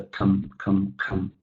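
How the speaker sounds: low, soft throwaway "come"; reduced "come", quiet and short; careless unstressed syllable. Shown as a demonstration. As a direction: mumble "come" as if it doesn't matter. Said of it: "I kinda don't even care about".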